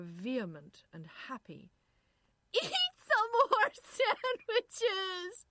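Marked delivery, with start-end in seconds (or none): 2.5-5.5 very high, loud, drawn-out squeal; party shriek. A narrator's reading. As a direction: shriek over a rowdy cocktail party. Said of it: "a woman shrieked".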